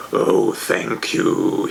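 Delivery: very slow, deep bass in a changed voice; thundering dragon. A narrator's reading. Dragon thundering thanks to the guests for his cake. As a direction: rumble it like a pleased dragon. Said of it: "he thundered with pleasure, in a bass".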